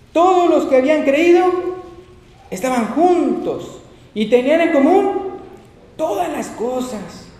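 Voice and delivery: loud, high, slow proclamation; scripture reading. Acted. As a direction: proclaim the verse to the congregation. Reads scripture aloud at full force.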